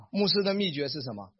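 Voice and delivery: usual speaking voice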